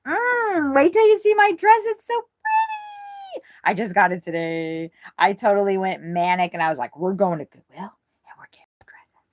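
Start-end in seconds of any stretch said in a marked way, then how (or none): 0.8-3.5 excited. Real, not acted. Excited about new dress. 7.0-9.2 whisper. Retold or imitated. Retold manic decision, whispered.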